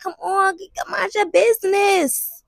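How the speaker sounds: high, slow whine at onlookers; high drawn-out complaint; mock annoyance. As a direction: drag it out, exasperated. Acted annoyance at nosy onlookers.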